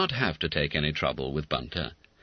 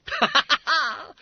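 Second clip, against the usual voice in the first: high shrieks of laughter; shrieking laughter; 4 dB louder, slower than usual. A narrator's reading. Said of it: "shrieked the famous five".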